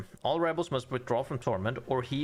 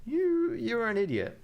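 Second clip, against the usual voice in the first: much higher, slower exasperated insult; exasperated outburst; 11 semitones higher, slower than usual. Real, not acted. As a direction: yell your frustration at the king. Scolding the game king.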